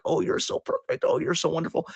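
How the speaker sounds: put-on high, whispery baby talk to a cat. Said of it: "a baby voice on".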